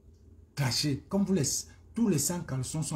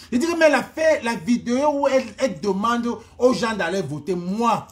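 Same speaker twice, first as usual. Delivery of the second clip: défensive; 8 semitones higher, 8 dB louder than usual. Real, not acted. Se défend contre une accusation.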